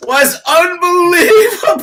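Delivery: high, loud excited shout; crying out in disbelief; half-laughing shout of amazement. Real, not acted. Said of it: "[name]'s response was legendary".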